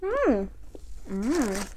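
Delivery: humming through closed full mouth; muffled by food in cheeks; chewing with mouth full. Real, not acted. Seen 0: bites taquito at -5, cheeks full, chewing through labelled hums.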